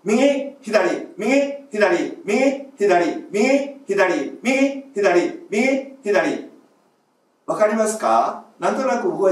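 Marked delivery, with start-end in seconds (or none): 0.0-6.5 short loud calls, 右 and 左 higher; loud drill calls. Shown as a demonstration. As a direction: call each side out short, from the belly. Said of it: "大きな声で短くでやります". (大きな声で短く)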